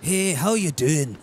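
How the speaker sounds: put-on trying out a Scottish accent; amateur Scottish accent, feeling for the sound; Scottish accent attempt. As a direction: rehearse a greeting in put-on Scottish. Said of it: "that's not Scottish".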